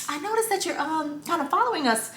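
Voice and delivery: polite with a smile. Retold or imitated. Customer telling a worker she is following them, softly polite. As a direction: raise it gently and politely, smiling. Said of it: "smile on my face".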